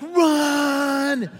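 loud, high, long-held yell; acted panicked shout. Leader to kids after hearing a bear, acted panicked shout. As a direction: yell it as an urgent command.

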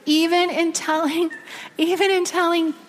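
real choked-up telling; getting teary. Real, not acted. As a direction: struggle through it on the edge of tears. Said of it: "I'm getting teary".

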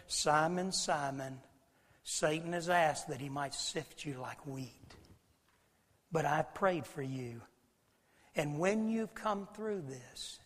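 soft, slow, tender reassurance; gentle warning. Retold or imitated. Jesus reassuring Peter before his failure, soft gentle warning. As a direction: speak softly and slowly, as if consoling.

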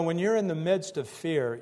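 ordinary delivery

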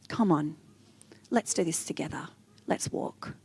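Jesus softly calling you to walk with him; gentle whisper. Acted. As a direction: drop to a hushed, tender whisper. Jesus voiced inviting to walk.

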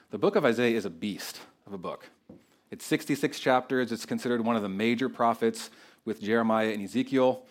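ordinary talk, nothing marked

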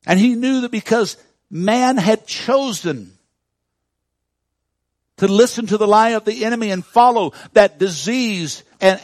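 loud, high-pitched preaching, slowed on key words; full-throated preacher's build; preaching at full force. Acted. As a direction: thunder it from the pulpit. Preaching climax on sin and death.

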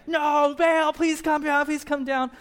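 very high, loud mock wail; hammed-up desperate plea to the heavens; frantic begging. Desperate prophets begging Baal, high mock wailing. Prophets beg their silent god.

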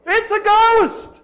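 loud, very high drawn-out yell; acted shriek of fright; terrified cry. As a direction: cry out in fear, stretching the words. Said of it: "In their fear, they cried out".

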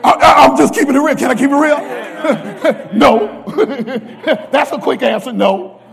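high, loud, fast and laughing; laughing confession. Real, not acted. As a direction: laugh it out, keeping it real.

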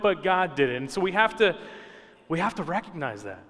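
ordinary talk, nothing marked

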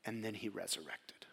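whispered reveal; awed whisper. Real, not acted. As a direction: breathe it like a secret. Announcing resurrection.

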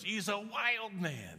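very high, loud, slow amazed shout; amazed crowd cry. Retold or imitated. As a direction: stretch it out like the whole crowd is in awe. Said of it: "everybody goes".

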